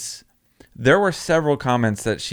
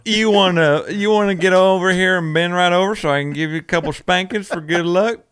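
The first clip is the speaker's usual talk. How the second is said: put-on country accent; 5 semitones higher than usual. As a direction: ham up a flirty country accent. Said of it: "He has a country accent".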